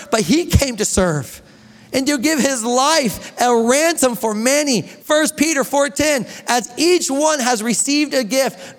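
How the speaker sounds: preacher reading verses to the church; scripture reading. Acted. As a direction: read the verses out with weight. Reads scripture aloud.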